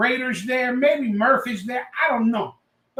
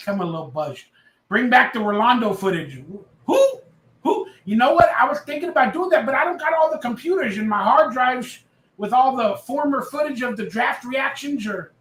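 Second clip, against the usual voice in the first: ordinary talk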